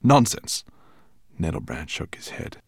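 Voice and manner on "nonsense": dismissive dragon. A narrator's reading. Dragon dismisses raven's offer.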